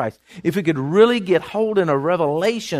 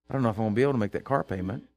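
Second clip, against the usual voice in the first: fast worried mumble; 8 semitones lower, 8 dB quieter, much faster than usual. Retold or imitated. Imagined worried prayer.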